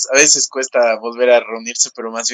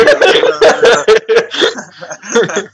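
laughing; 9 semitones higher, 3 dB louder, faster than usual. Real, not acted. Cracking up in blooper.

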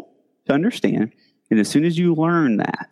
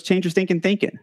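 imitating Zig Ziglar; 7 semitones higher than usual. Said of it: "he goes".

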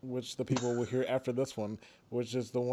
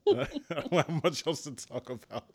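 laughing; 5 semitones higher, 3 dB louder than usual. Real, not acted. Laughing wrap-up.

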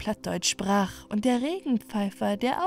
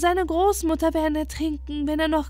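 higher plaintive plea; lamenting birds; 7 semitones higher than usual. Acted. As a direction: complain anxiously to the moon. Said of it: "klagten die Vögel". (höheres, klagendes Bitten)

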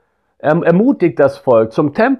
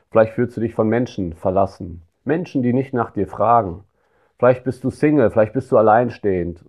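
ordinary delivery

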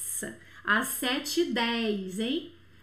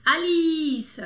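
Alice called out, the i stretched and louder; stress on the final i; 3 semitones higher, 7 dB louder than usual. Shown as a demonstration. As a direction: lean long and loud on the i in Alice. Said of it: "Pode ver a sílaba tônica no i". (Alice com a sílaba tônica no i, alongada)

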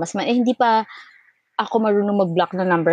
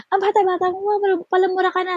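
much higher mock scolding; scolding older caller; 12 semitones higher than usual. Older caller scolding her for cursing, high mock scolding. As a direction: scold her like a shocked elder. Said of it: "Sabi niya".

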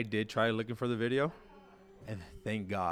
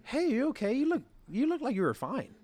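high, light imitation of the girlfriend; girlfriend's voice; 14 semitones higher than usual. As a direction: mimic her teasing concern, pitched high. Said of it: "She tells me the next day".

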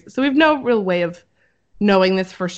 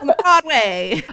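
put-on higher, drawn-out old radio announcer; old-time radio voice; 6 semitones higher, slower than usual. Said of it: "like, the old-time radio voice".

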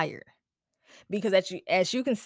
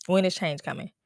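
ordinary talk

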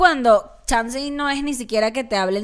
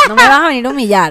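mock offended; 3 semitones higher, 9 dB louder, much faster than usual.

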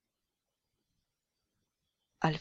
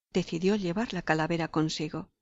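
plain reading voice

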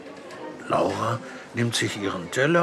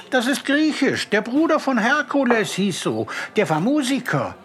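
put-on higher, bright know-it-all girl; eager girl Laura; 10 semitones higher than usual. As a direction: chime in proudly like a little girl. Girl character voice. (Laura mischt sich eifrig ein, mit hoher Mädchenstimme)

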